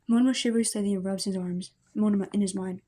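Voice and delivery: ordinary delivery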